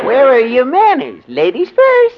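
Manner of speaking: very high mock dainty voice; high elf voice. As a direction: pipe it up in a silly falsetto. [name] mocks in elf voice.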